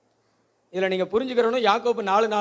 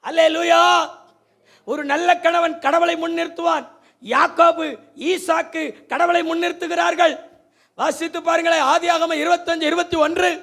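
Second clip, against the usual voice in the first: higher and louder, building sermon; fiery preaching; 8 semitones higher, 7 dB louder than usual. Acted. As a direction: drive the point home from the pulpit. Preaching at full force.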